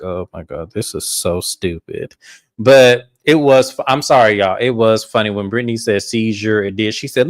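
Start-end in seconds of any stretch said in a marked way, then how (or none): 0.0-2.2 softer, slow exasperated aside; muttered aside. Retold or imitated. Host recalling her silent reaction at the table, soft and slow. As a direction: mutter it under your breath. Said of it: "I'm looking at Meredith like".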